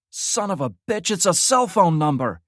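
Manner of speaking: high, loud burst of discovery; excited exclamation. Acted. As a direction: blurt it out, amazed. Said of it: "Frost exclaimed".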